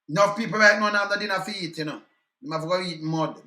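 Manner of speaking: ordinary delivery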